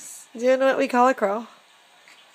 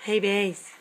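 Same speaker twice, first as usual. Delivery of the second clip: ordinary talk, nothing marked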